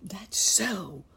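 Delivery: high, airy stage whisper. A narrator's reading. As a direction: whisper it urgently but audibly. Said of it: "whispered Uncle Wiggily to the horse".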